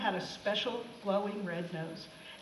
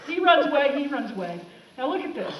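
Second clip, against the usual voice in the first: ordinary delivery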